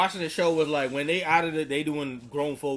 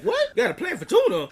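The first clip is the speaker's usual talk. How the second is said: very high, loud squeaky question; mock squeaky Cal; 6 dB louder than usual. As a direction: squeak it out in disbelief. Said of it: "And Cal like".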